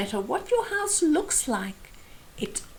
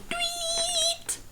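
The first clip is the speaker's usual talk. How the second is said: very high thin whistle sound; whistle tweet; 6 dB quieter than usual. Acted. Hornbill ending the contest, whistle tweet. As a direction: squeak it like a referee's whistle. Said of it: "blowing the whistle, saying".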